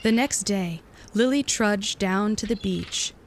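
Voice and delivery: plain reading voice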